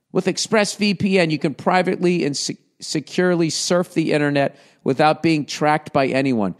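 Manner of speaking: upbeat sponsor read. Acted. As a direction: read it like a podcast ad. Reads the ad copy.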